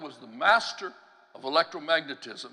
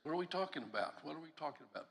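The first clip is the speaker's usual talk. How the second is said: very soft, puzzled aside to oneself; muttered inner thought; 13 dB quieter, faster than usual. Retold or imitated. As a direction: mutter it under your breath, trailing quieter. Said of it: "your mind is just kind of turning and saying".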